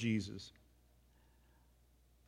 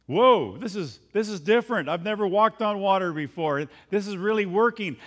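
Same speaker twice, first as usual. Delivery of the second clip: loud, higher, thrilled disbelief; amazed Peter; 5 semitones higher, 7 dB louder than usual. Acted. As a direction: play Peter marvelling at his first steps. Peter amazed walking on water.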